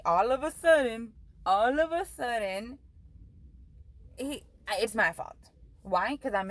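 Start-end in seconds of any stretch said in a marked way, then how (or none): 0.0-1.1 all of a sudden, punched out louder; louder emphasis. Real, not acted. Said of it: "repeats phrase for emphasis". 1.4-2.8 all of a sudden, drawn out slowly word by word; slow down and lean on all of a sudden; slow emphasis. Real, not acted. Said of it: "repeats phrase slower".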